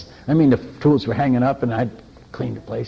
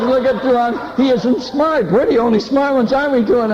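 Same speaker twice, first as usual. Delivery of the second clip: teasing laughter; 9 semitones higher, 4 dB louder than usual. Retold or imitated. Villager teasing the visitor in their language.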